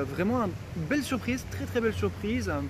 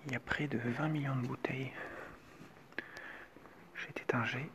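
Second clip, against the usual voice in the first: hushed tour guide whisper; 6 semitones lower, 13 dB quieter than usual. Real, not acted. Whispering tour in champagne cellars.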